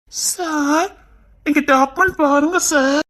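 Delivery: high, very slow sugary okay; hammed-up falsetto lady voice; put-on girly voice. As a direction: coo it like a coy young woman.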